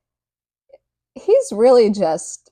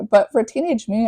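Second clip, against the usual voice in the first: ordinary talk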